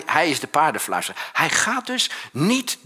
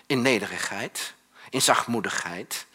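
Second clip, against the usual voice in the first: usual speaking voice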